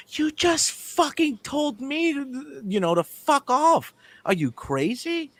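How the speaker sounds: high, slow, incredulous; mock-outraged customer; indignant disbelief. Indignant retort to the clerk.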